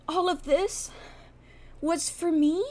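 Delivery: high, mock-touched squeak; overcome girlfriend. Girlfriend comes up to injured boyfriend, high and mock-touched. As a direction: squeak it as if moved beyond words.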